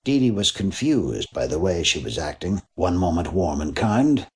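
plain reading voice

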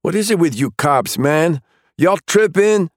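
high, loud exasperated complaint; whiny young thug. Acted. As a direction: gripe at the cops. Suspect complaining to cops.

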